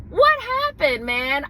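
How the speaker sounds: high, loud burst of dismay; exclaimed disbelief. Real, not acted. New Yorker learning the accent is distrusted, high dismayed outburst. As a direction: cry out in disbelief at the news. Mock outrage at poll result.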